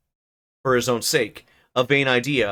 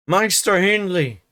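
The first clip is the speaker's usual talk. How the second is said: put-on shouting old servant; 6 semitones higher than usual. Joseph calls to the master in a high rustic shout. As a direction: call the master across the house, outraged. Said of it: "shouted our chaplain".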